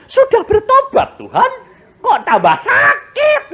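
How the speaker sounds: high whiny mock complaint to God. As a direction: imitate a grumbling believer asking why. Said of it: "ini kerap kali orang Kristen". (meniru jemaat yang mengeluh dengan suara tinggi)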